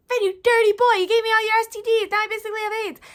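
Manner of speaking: high, loud mock outrage; retold outrage at a friend; accusing yell. Accusing Ben in retold scene.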